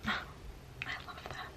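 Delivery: breathless whispered sigh. Real, not acted. Dreaming of raising daughters like Marmee, whispered.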